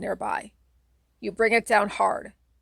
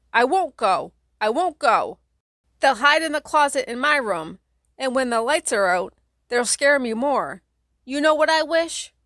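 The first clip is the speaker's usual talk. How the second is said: loud, higher stubborn whine; whining child; 5 semitones higher, 5 dB louder than usual. Acted. Kate whining to her sibling about the closet, loud and high. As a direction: whine and stomp like a scared little girl. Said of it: "Kate whines".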